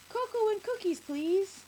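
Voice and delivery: put-on very high, loud child voice; eager little boy. A narrator's reading. Little boy ordering at the cafe, eager. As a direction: pipe up eagerly like a small boy. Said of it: "the little boy says".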